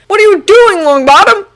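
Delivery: alarmed cry, high and loud; cried in alarm. Acted. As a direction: shout it, jumping up in alarm. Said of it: "Draco cried in alarm".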